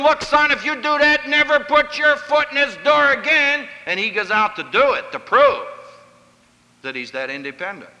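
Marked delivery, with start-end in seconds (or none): 0.0-3.7 higher, louder, a father laying down an ultimatum; stern father's threat. Retold or imitated. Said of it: "some father said to him". 5.1-5.7 prove pushed up high and drawn out; stress on prove. Real, not acted. Stressing the key word.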